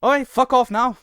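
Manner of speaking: muddled Mancunian with a hint of Arabic, pitched high; high-pitched, garbled northern English welcome; mixed Mancunian accent. Streamer greeting viewers to the stream, garbled Mancunian accent. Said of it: "a little bit of Mancunian in it".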